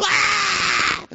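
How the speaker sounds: high mock scream of a scared kid; little son screaming in the truck, hammed-up; child scream. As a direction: yelp it high like the scared boy. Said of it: "he was screaming the whole time".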